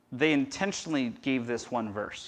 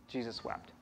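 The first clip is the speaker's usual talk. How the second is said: much softer, reverent and still; hushed; 11 dB quieter than usual. Acted. Pastor reading the verse in a hushed, reverent voice. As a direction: let it drop almost to nothing.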